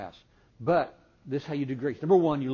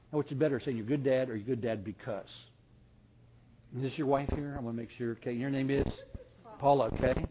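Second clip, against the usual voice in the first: ordinary delivery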